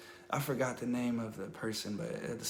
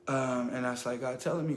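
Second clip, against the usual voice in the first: ordinary delivery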